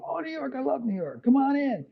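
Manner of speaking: high, hammed-up voice of a delighted studio engineer; imitating the engineer. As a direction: jump way up in pitch like a thrilled engineer welcoming you in. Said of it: "He goes".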